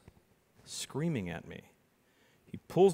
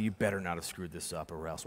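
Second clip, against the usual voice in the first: low, soft menacing growl; menacing threat; 7 semitones lower, 8 dB quieter than usual. Retold or imitated. Teenage magician threatening the client, low and menacing. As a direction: threaten him quietly, all menace. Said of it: "all the menace that nineteen-year-old me could muster".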